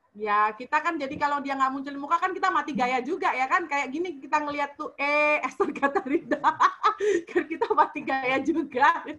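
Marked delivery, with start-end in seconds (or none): 5.0-9.1 laughing while telling an awkward moment. Real, not acted. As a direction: talk through laughter, louder. Laughing at awkward video call memory.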